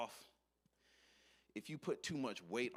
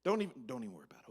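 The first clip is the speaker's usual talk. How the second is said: fast and louder brush-off; rushed brush-off; 7 dB louder, much faster than usual. Acted. Worker hurrying the boss along, rushed and louder. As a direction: rattle it off to end the talk.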